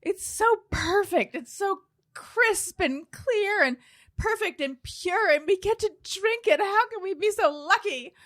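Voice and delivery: high, slow, dreamy rapture; gushing over water. Retold or imitated. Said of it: "someone posted something like".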